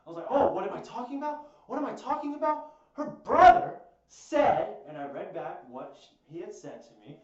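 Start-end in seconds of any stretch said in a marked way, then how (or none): 0.3-4.8 raised, high-pitched angry shouting; retold argument at full volume; losing it. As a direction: throw the question back loudly, incensed. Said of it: "like, I lost it".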